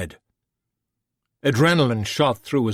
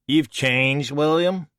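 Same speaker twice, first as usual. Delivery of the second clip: Persephone, mocking; 6 semitones higher, 4 dB louder, slower than usual. Persephone teasing.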